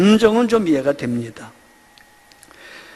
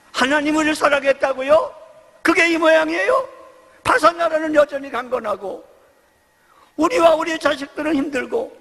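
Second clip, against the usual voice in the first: higher, shouted indignant challenge; indignant protest; 7 semitones higher than usual. Retold or imitated. Israel protesting to God, indignant shout. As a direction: throw the complaint back at God.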